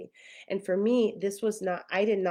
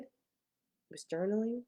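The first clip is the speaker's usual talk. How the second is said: ordinary talk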